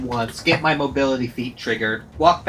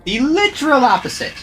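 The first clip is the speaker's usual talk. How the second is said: loud, high, fired-up rant; excited rant; 9 semitones higher, 6 dB louder than usual. Real, not acted. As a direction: rant with gleeful conviction. Ranting about unfair fights.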